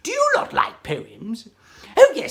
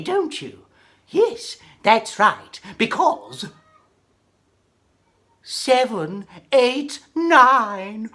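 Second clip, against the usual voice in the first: plain reading voice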